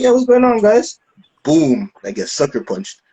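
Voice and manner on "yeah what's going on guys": high, loud re-enacted greeting; big casual hail; upbeat greeting. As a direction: call out to the guys, pitched up. Retold friendly greeting before punch.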